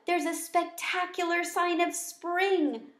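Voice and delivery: a small pet bursting with news; excited squeak. Acted. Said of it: "I squeaked excitedly".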